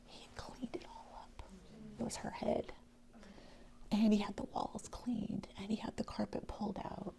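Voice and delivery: tearful near-whisper; choked-up whispered recollection; near tears. Real, not acted. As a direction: hold back real tears, barely voiced. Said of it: "I'll just cry".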